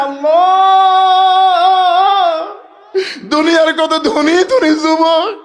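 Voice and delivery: very high, loud chanted wail; acted wailing lament. Prophet crying to Allah about his daughter, wailing lament. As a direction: stretch the cry to God, then lament loudly.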